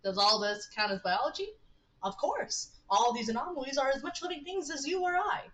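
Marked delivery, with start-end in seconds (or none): 2.0-5.4 higher-pitched, determined insistence; great determination. Acted. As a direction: insist on it with rising conviction. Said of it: "great determination in his voice".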